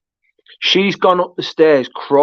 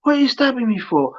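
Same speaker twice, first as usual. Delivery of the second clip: imitating stabbed film character; 10 semitones higher than usual. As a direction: act out the film victim's bewildered plea in a raised pitch. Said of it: "he's like".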